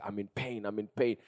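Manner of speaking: put-on very soft, low quote of a hurting child; subdued, understated plea; retold pained complaint. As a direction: voice the daughter's pain under your breath. Voices frantic daughter in pain.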